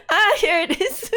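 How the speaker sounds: high, loud, laughing through the words; laughing relief. Real, not acted. Streamer finds her slides at last, laughing loudly.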